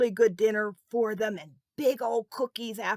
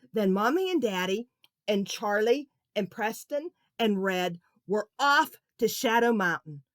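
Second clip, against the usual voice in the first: plain reading voice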